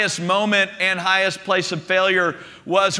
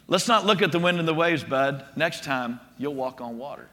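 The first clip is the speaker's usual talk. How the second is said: soft, lower, trailing down quietly; gentle correction; 5 semitones lower, 5 dB quieter than usual. Retold or imitated. Jesus to Peter back in the boat, gentle correction. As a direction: let him down easy, voice dropping away.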